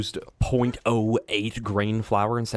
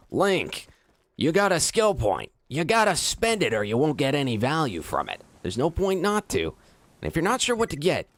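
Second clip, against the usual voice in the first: higher, chirpy helper voice; mock game helper; 3 semitones higher than usual. Streamer mocking the in-game helper nagging Link, higher. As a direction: nag like a game guide character. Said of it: "Link, that's a boss nearby".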